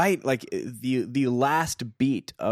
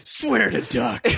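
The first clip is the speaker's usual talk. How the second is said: Batman voice; 10 semitones higher, slower than usual. Pretend. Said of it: "Christopher Nolan Dark Knight".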